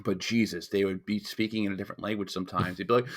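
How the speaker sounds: ordinary talk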